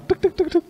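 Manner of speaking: high imitated knock-knock; playful knock sounds for a door. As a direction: tap out the knocking with your voice.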